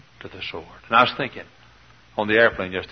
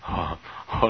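ordinary talk, nothing marked

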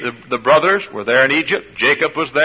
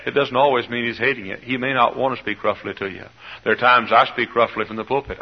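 ordinary talk

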